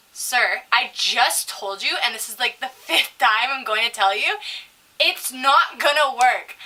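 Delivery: slow, emphatic insistence; exasperated server. Retold or imitated. Server telling customer the card fails, slow and exasperated.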